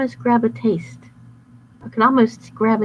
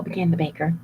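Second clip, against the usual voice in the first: usual speaking voice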